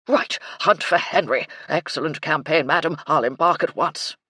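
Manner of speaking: loud, eager military acknowledgement; blustery colonel. A narrator's reading. Colonel accepting madam's mission, loud and eager. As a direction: take orders like a keen old colonel.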